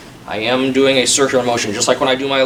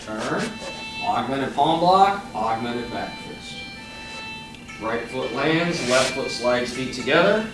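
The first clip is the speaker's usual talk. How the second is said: crisp called-out form commands; sharp martial arts commands; 4 dB quieter than usual. Shown as a demonstration. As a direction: call each move like a drill. Calls form movements over music.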